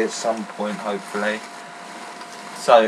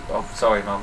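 ordinary talk, nothing marked

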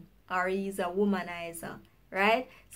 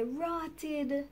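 disbelief; 4 semitones higher, 7 dB quieter than usual. Retold or imitated. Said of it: "you can say".

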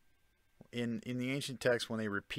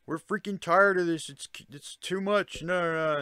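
high, loud exasperated outburst; a mock outcry of workers sick of the toil; fed-up workers' protest; 7 semitones higher, 6 dB louder than usual. Rebel workers telling the gods they're done, exasperated and loud.

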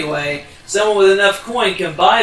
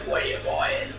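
much lower, hushed shady shopkeeper; secretive raspy sales murmur; 10 dB quieter than usual. Retold or imitated. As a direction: lurk and tempt like a back-alley trader. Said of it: "He's just like".

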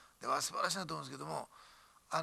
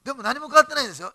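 louder, higher and fast, pressing the point; emphatic outburst; 9 semitones higher, 15 dB louder, faster than usual. Real, not acted. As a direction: burst out to drive it home. Preacher stresses nothing changed.

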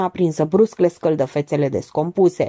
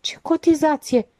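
high, baffled question; clueless puzzlement; 10 semitones higher than usual. A narrator's reading. Gheorghiu has no idea what is meant, asks bewildered. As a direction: ask as if fallen from the moon. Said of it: "căzut din lună Gheorghiu".

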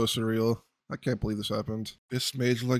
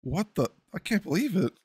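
much higher, gasping disbelief at the drop; stunned disbelief; 6 semitones higher than usual. Real, not acted. Gamer reacts to a surprise crossbow drop, stunned. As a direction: sound floored by sheer luck. Second rare drop appears.

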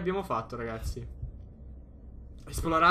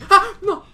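startled scream; 16 dB louder than usual. Real, not acted. Jump scare.

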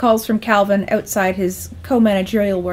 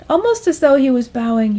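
ordinary delivery, nothing marked

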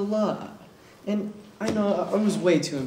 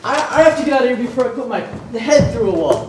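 frantic; 5 semitones higher, 8 dB louder, faster than usual. Acted. Teacher flees in frustration.

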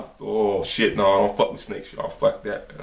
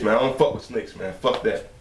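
ordinary talk, nothing marked

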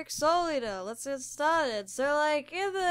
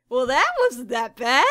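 much louder, amazed relief; pleased surprise; 9 dB louder than usual. Pretend. Artist pleased his tricky drawing worked out, loud delighted relief. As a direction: announce it, delighted it went well.

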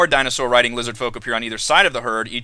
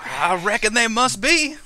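put-on folksy lizard-folk country accent; country rancher accent; 8 semitones higher than usual. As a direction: retort in a high rancher accent. Lizard mother answers her son.

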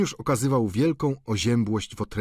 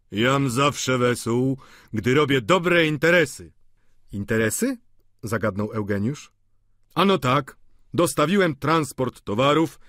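jovial boast; 4 semitones higher, 4 dB louder than usual. Acted. Vautrin boasting cheerfully.

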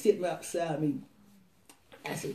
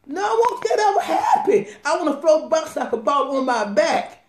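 real high, loud burst of joy; excited exclamation; 7 semitones higher, 9 dB louder than usual. Real, not acted. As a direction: cry out your denial, overflowing with happiness. Emphatic answer to being asked scared.

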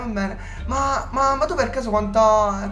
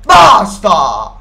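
much louder, hammed-up yelling; mock angry shout; 3 semitones higher, 9 dB louder, slower than usual. Warning Thomas to get serious, mock furious shouting. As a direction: bark it at him to stop.

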